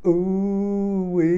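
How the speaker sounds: high delighted ooh-wee squeal; excited squeal over the fragrance. Pretend. Excited reaction to theme song.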